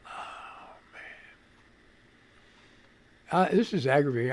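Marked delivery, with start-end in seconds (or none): 0.1-1.0 whispered oh man. Real, not acted. Stream keeps breaking.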